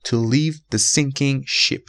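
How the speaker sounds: slow, captain said two ways; slow example reading. Shown as a demonstration. Teacher reads the captain example slowly for students. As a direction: read the second example slowly. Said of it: "مثال دوم".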